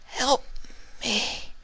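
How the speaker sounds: a fading, soft plea; mumbled. A narrator's reading. Vinyl drugged and fading, mumbling. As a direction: mumble it as you pass out. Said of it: "she mumbled".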